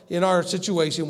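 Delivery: ordinary talk, nothing marked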